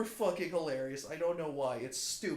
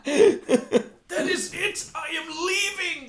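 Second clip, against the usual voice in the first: mock outrage; 12 semitones higher, 8 dB louder, much slower than usual. Mock storming out.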